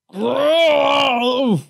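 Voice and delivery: long, loud, high groggy roar; wordless bellow on waking; groggy shout. A narrator's reading. Groggy man waking on a stretcher, roaring. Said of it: "Large shouted groggily".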